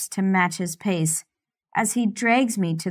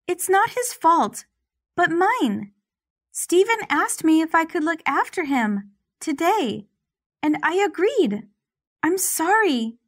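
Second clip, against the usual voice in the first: put-on timid young woman; 9 semitones higher than usual. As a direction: speak up nervously, high. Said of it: "Penelope speaks as she takes a step front".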